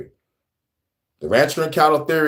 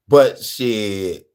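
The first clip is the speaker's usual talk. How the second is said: ordinary talk, nothing marked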